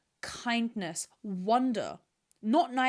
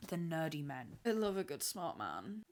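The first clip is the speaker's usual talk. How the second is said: moaning; 3 semitones lower, 8 dB quieter than usual. Real, not acted. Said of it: "I just fucking moaned".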